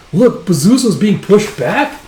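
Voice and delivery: high, loud exclamation of disbelief; astonished onlooker. Acted. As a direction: cry out in amazement from the battlefield. Soldier alarmed in battle retelling.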